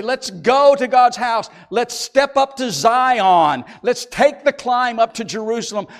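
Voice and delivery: loud, rousing call to the pilgrims; the leader calling the people up to Jerusalem, loud and rousing; rallying call. Retold or imitated. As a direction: lead the crowd up to the house of God. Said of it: "I used to be the one to say".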